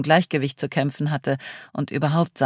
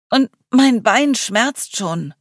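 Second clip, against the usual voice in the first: slow, whiny, hurting; pained complaint; 8 semitones higher, 6 dB louder, much slower than usual. Acted. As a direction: drag it out in pain. Cleo hopping in pain.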